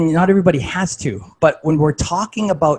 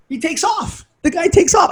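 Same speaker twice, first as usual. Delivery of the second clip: incredulous. Retold or imitated. Speaker marveling the coach just walked off, incredulous high. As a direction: pitch jumps much higher in disbelief.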